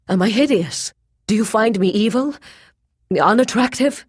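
high, loud, rising challenge; hurt demand. A narrator's reading. Rejected captive asking what she finds wrong, hurt demand. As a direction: challenge her with wounded questions.

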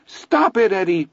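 put-on very high, loud child's scream. A narrator's reading. Little brother begging the boys to stop, screaming high. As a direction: shriek it like a terrified little boy. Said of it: "Billy screams".

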